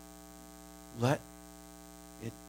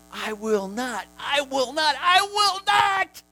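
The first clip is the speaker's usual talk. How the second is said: climbing higher and louder to a held cry; building preaching shout; 12 semitones higher, 8 dB louder, slower than usual. Acted. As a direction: build each repeat toward a shout. Preacher builds triple repetition.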